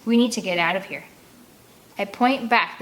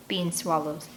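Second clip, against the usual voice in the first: ordinary delivery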